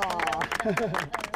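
brief falling chuckle; a light laugh after thanks; short laugh. Real, not acted.

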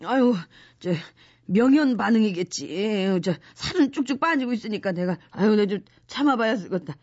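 low, nauseous groaning; queasy, sick voice. Acted. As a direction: sound sick and dizzy. Sick and dizzy from pills.

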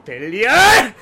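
high, slow, screamed battle cry. Acted. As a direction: scream it as you strike. Battle cry while attacking.

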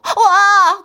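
drawn-out, high, loud wow; imitated excited shout. Mother retelling her daughter's outburst, high excited shout. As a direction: mimic the child's delighted yell. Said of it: "그 소리쳤어요".

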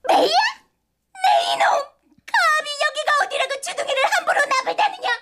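high, shrill-scolding evil queen voice; period drama villainess. Acted. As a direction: berate them like a palace villainess. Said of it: "사극에 나오는 악녀 연기를 해볼게".

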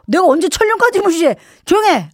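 high-pitched protest in a mock family row; indignant, emphatic squabbling; heated bickering. Acted mother-daughter shouting match.

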